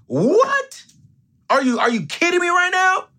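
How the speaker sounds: very high, far-off shocked squeal; disbelief. Pretend. Host reacting to the clothes answers, high shocked squeal. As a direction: shriek it in total disbelief.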